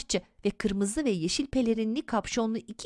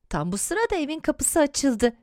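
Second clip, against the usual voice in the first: plain reading voice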